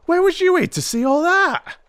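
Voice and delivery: high, loud cockney constable cry; frightened cry. A narrator's reading. Constable cries out to Holmes in fright. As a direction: blurt it out in a frightened yelp. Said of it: "he cried".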